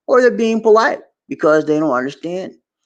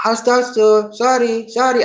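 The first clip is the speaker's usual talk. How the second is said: imitated apologetic clerk; 7 semitones higher than usual. Imitates shop clerk turning him down.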